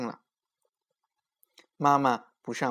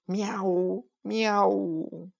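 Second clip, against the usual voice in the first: lightly higher meow; boy meowing; 7 semitones higher, much slower than usual. A narrator's reading. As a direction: meow like a cat. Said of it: "小明眯着眼睛，张着嘴巴，对着猫妈妈叫了两声".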